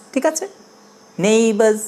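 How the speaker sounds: ordinary delivery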